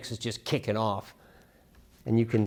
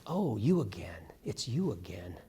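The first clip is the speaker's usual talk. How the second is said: whispered; 2 semitones lower, 7 dB quieter than usual. Shown as a demonstration. Softly delivered title.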